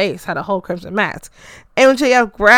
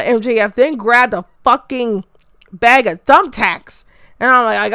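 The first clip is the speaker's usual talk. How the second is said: usual speaking voice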